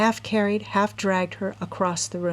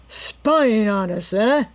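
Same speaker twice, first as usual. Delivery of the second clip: slow, louder menacing snarl; 3 semitones higher, 6 dB louder, slower than usual. A narrator's reading. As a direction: drag it out threateningly. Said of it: "he snarled".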